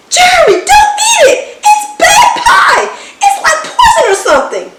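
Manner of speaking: high, loud, fast panicked yell; panicked shout. A narrator's reading. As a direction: shout in a panic to stop him eating. Panicked warning to stop friend.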